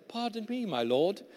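mock-British Gideon, starting high; British accent. Gideon goes straight back to the Lord, British accent. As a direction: reprise the polite British accent. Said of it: "So one more time".

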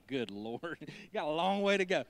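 a laughing Lord teasing; laughing Jesus voice. Retold or imitated. Jesus laughing at the preacher, amused and teasing. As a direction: chuckle through it, amused.

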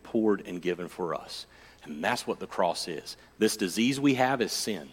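low, hushed sermon storytelling; solemn story narration. Real, not acted. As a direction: tell it softly and gravely, with long pauses.

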